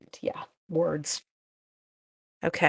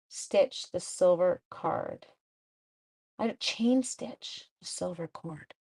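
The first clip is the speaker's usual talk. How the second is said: ordinary talk, nothing marked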